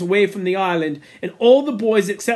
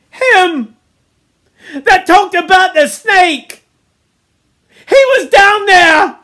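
high, loud horrified cries; Piggy gasping, panicked; 12 semitones higher, 10 dB louder than usual. A narrator's reading. Piggy realises the child was by the fire, panicked shouting. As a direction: break it up with gasps and cry out. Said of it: "he was gasping for breath".

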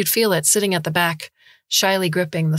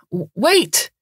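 loud startled cry; shouting; 6 semitones higher, 4 dB louder than usual. Acted. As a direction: call out in alarm. Dante calls after the thief.

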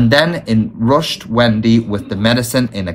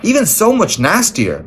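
high, emphatic boast with a touch of bravery; brave front; 9 semitones higher than usual. A narrator's reading. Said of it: "Mister Darling said bravely".

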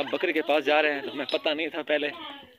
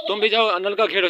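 calling out; 7 semitones higher, 6 dB louder than usual. Real, not acted. Shouting orders at kids.

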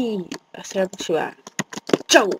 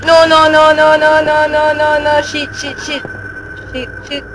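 high, loud, frantic no; real terror pouring out in a loud, high run; panicked cries; 7 semitones higher, 5 dB louder than usual. Real, not acted. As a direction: scream your denial as it closes in. Panicking as something chases.

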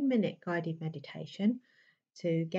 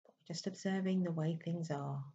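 calm, unhurried meditation voice with long pauses; even, settled delivery in short, spaced phrases; guided meditation; 3 dB quieter than usual. Acted. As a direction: gently guide them inward, leaving space between phrases.

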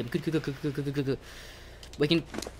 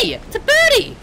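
delighted squeal; 5 dB louder than usual. Real, not acted. Spots a bird in game.

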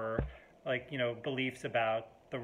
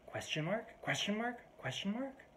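high rising incredulous query, softer; each one lifting sharply into a question; 8 semitones higher, 5 dB quieter than usual. Retold or imitated. Group member writing back to joke, incredulous rising query. Acting out a curt reply email.

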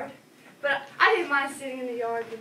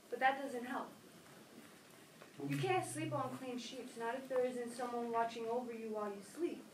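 much softer, lonely and subdued; sad quiet; 3 semitones lower, 14 dB quieter than usual. Acted. Child quietly confesses loneliness since ma died, sad. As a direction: let it drop to a sorrowful hush.

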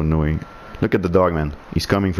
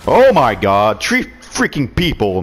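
excited yell; 6 semitones higher, 5 dB louder than usual. Real, not acted. Gamer yelling at a multikill.

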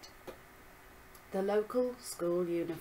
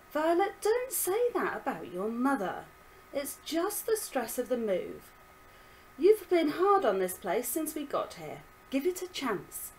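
father's sharp, louder reproach; snapped; 6 semitones higher, 4 dB louder than usual. Acted. Father snaps at Violet for criticising her mother. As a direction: snap at her to stop it. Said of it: "he snapped".

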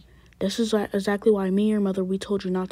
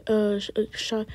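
ordinary talk, nothing marked

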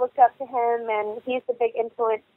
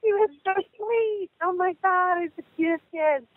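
choked up over a sweet memory; real tears welling while gushing; tearful; 7 semitones higher than usual. Real, not acted. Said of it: "start crying".

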